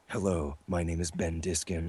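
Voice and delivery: a low, smoky introduction for a voice audition; deep sexy slate voice. Shown as a demonstration. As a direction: purr your own name like a seductive villain. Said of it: "deeper and sexier sound".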